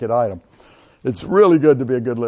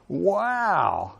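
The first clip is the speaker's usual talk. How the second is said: amazed wow; 4 semitones higher, 6 dB quieter than usual. Shown as a demonstration. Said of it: "with an amazed facial expression".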